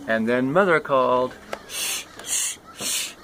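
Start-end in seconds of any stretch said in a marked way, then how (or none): 1.7-3.1 soft breathy hush; shushing. Acted. Mother shushing the child, soft and breathy.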